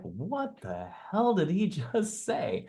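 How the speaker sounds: high, slow, laughing disbelief; bewildered listener, pitch swooping up; baffled. Acted. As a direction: stretch it out in stunned confusion, half laughing. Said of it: "Just like".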